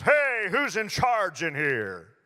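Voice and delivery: big, slow, booming bellow. Acted. Big man booming at a roomful of toddlers. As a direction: boom it out like a towering man. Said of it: "he says with a booming voice".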